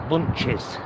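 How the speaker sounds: ordinary talk, nothing marked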